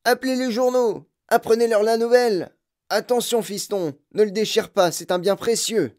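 high, loud excited shouting; overexcited shopkeeper, yelling high; frenzied yelling. A narrator's reading. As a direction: holler the news to all. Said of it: "hurla-t-il".

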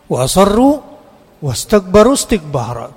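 repeated line in a lighter chant; recited verse. Acted.

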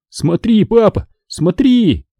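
high, drawn-out excited call; joyful yelling. A narrator's reading. Boy yells to father from joy, joyful yelling. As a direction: urge him to look, overjoyed. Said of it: "вопил я от радости".

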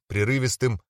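ordinary delivery

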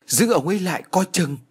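a wife screaming to stop her husband; panicked cry. A narrator's reading. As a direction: yell for help in a panic. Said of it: "bà hội đồng hốt hoảng".

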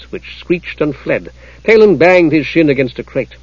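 usual speaking voice

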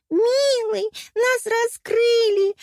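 very high, drawn-out theatrical wail; mock lover's dismay. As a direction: ham up a secret lover caught out. Narrator mockingly plays the exposed lover. (притворный драматический ужас)

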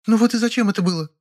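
put-on very high, cross young woman's voice; angry outburst. A narrator's reading. Natasha confronts her colleague, angry. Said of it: "она сердито выпалила".

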